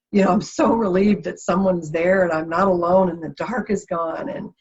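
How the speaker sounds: emotional, near weeping; tearful. Real, not acted. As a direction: let the relief spill over into tears. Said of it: "And I'm weeping".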